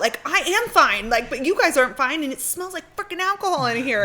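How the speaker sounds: high, loud frantic outburst; panicked retort. Retold or imitated. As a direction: snap in rising panic at reassuring friends. Acted panicked retort to tipsy friends.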